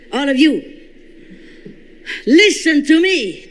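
loud, high commanding call to all; angry command. Retold or imitated. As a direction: order them to listen, full voice. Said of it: "I was very angry, louder and more angry".

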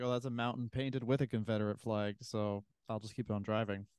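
deadpan. Retold or imitated. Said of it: "I'd be like".

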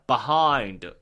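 "behind" as low buh-HIND; English pronunciation. Shown as a demonstration. Teacher correcting the Korean reading, English "behind" as buh-HIND. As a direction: reduce the start of "behind" to "buh". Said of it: "절대로 그게 아니라고요".

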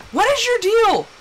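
high, exaggerated protest; mock exasperation. Wife retelling heater quarrel with husband, mock exasperation. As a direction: demand it in playful disbelief.

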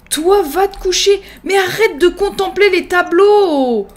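high, exasperated mock outburst; exasperated scolding. Player sends a Sim to bed, exasperated scolding. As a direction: order them off, stretching the end. Orders Sims about, exasperated.

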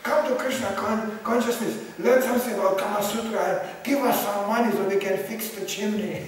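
hammed-up preaching sales pitch; parody preacher. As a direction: hawk it like a desperate fundraiser. Parodies preaching turned into fundraising.

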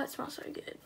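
a hushed whisper of pleasure. Real, not acted. Reviewer sniffing a vanilla squishy, whispering.

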